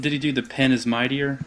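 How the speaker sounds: normal talking voice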